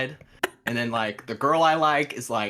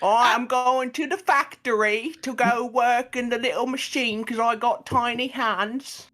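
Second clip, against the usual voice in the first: much higher, overdone Liverpool-ish British voice; silly British accent; 10 semitones higher than usual. Pretend. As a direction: play a cartoon Brit, pitched way up. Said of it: "that's all British people to me".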